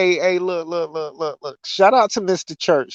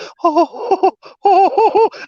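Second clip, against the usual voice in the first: high wheezing laugh; 4 dB louder than usual. Real, not acted. Recalling girlfriend's insult.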